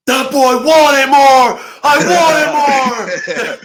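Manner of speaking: high, loud, slow scream with a laugh breaking in. Retold or imitated. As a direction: scream it like a man who couldn't get enough. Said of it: "And starts screaming".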